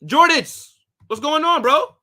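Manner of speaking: light up and call out the greeting; excited greeting. Real, not acted. Greeting a returning chatter.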